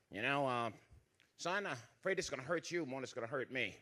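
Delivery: a sweetly sorry mother, mildly voiced; soft mother voice. Mother voiced before whipping.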